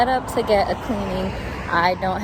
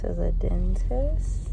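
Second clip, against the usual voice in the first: a real hushed whisper, barely voiced; slower than usual. Real, not acted. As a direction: whisper the answer slowly so the recording isn't disturbed. Answering mum while filming.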